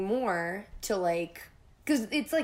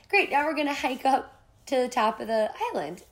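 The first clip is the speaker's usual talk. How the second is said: higher, bright mock-chipper friend voice; cheery friend; 5 semitones higher, 3 dB louder than usual. Imitating her chipper friend announcing the hike. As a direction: chirp it like a perky guide.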